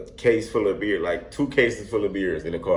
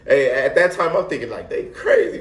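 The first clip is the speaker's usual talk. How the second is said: normal talking voice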